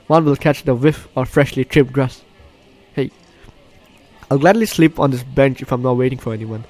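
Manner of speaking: usual speaking voice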